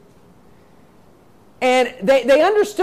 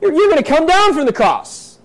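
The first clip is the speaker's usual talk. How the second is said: mocking; 5 semitones higher than usual. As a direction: taunt him with a higher, pushed voice. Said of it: "they're mocking him".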